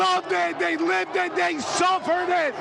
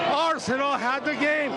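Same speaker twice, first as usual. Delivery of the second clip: ordinary talk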